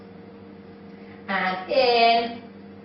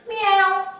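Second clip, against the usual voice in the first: high imitated cat meow; 7 semitones higher than usual. Shown as a demonstration. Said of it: "go into our cat".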